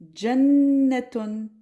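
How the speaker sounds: Jannatun stretched out slowly; slow Arabic word. Shown as a demonstration. Teacher models the last word slowly. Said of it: "on va faire un dernier mot".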